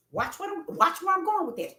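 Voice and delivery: ordinary talk, nothing marked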